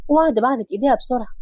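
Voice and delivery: plain reading voice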